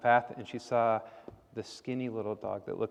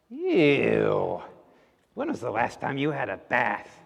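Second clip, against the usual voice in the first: disgusted witch; 6 dB louder, slower than usual. A narrator's reading.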